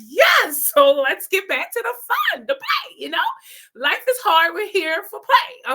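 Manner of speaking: high, loud burst of relief; relieved and eager. Retold or imitated. Speaker cheering the instructor's interruption, high and loud. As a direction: leap at the chance to get back to fun. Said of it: "And I'm kind of relieved".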